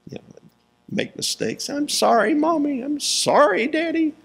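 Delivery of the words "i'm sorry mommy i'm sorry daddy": put-on much higher, a small child's pleading voice; little kid apologizing. Preacher imitating little kids apologizing to their parents, high childlike voice. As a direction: squeak it out like a sorry toddler. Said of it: "among little kids".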